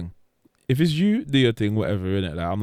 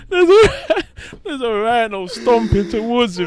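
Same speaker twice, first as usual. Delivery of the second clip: laughing; 8 semitones higher, 3 dB louder than usual. Real, not acted. Laughing while telling.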